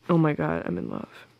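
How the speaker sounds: ordinary delivery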